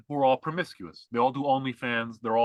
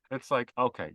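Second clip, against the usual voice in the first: ordinary delivery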